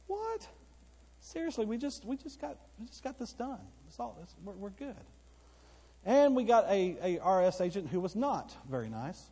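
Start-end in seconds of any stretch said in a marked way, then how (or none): 0.1-4.9 high disbelieving squeak, trailing off softer; incredulous what. Retold or imitated. Pastor retelling his shock at new IRS letters, high incredulous voice. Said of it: "And I'm going".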